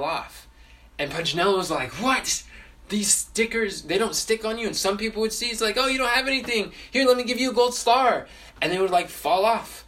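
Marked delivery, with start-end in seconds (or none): 2.0-4.7 high-pitched, astonished question; mildly acted disbelief, pitched up; amazed puppet. Puppet amazed that stickers fall off. 5.8-8.3 high, gushing retold offer; louder toward the end, keen to give; eager offer. Townspeople eagerly offering a star.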